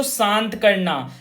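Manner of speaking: drawn-out teacher answer, slowly; slow dictation. Shown as a demonstration. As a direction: spell it out slowly for the class. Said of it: "एले का मतलब क्या होगा बच्चों".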